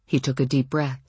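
plain reading voice

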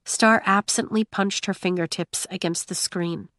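ordinary delivery, nothing marked

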